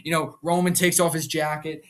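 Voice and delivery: ordinary talk, nothing marked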